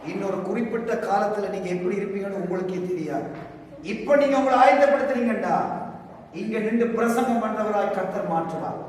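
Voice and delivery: ordinary talk, nothing marked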